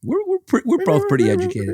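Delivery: squeaky high seal voice. Pretend. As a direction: bark it out like a seal.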